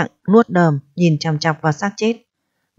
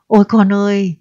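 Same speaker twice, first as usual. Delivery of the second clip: shrill crying wail; 2 semitones higher, 3 dB louder than usual. A narrator's reading. Mother wails over her drowned son, shrill crying. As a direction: cry out in anguish, voice ringing. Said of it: "lanh lảnh khóc".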